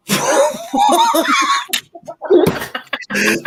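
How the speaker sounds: big high burst of laughter. Real, not acted. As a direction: howl with laughter. Whole bus laughing.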